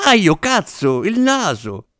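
high, wailing hurt complaint; cry of pain. A narrator's reading. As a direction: yelp as if just hit on the nose. Actor hit on the nose. (lamento acuto di dolore)

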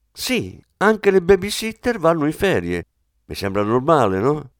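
a hint of a brisk wife's voice; Anna, lightly voiced wife. A narrator's reading. Wife teasing her husband about the kids, lightly voiced.

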